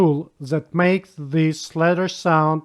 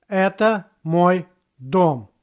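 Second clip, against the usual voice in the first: word by word. Shown as a demonstration. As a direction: separate each word of Это мой дом for a learner. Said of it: "how it looks in a simple sentence".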